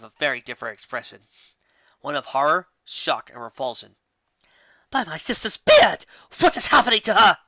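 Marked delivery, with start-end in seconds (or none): 4.9-7.4 higher, louder horrified outcry; a princess shocked and appalled, crying out; exclaimed. Acted. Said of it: "exclaimed the princess".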